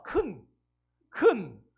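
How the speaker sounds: put-on father drilling 큰 into his toddler, loud; stressing 큰. Shown as a demonstration. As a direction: hammer the syllable 큰 for the child. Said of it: "교육을 시켰어요".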